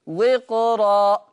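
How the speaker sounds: وقرا very slow with a full, heavy ra; slow, full-mouthed وقرا. Shown as a demonstration. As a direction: draw out وقرا and weigh down the ra. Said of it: "ما ينفعش".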